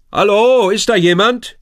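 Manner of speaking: loud, high call with a falling end; a boy insisting someone on the boat answer. A narrator's reading. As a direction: call out firmly, demanding an answer. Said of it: "ruft Lukas".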